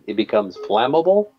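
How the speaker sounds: ordinary delivery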